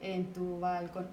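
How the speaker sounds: slow, low, even monotone, syllable by syllable; syllable-counting recitation. Shown as a demonstration. Teacher recalling how school poems were measured, syllable-counting recitation. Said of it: "And we counted the syllables".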